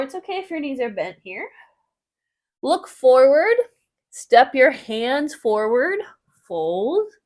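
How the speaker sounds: clear, steady yoga class cues; yoga instructor cueing. Acted. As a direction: guide the class through each pose.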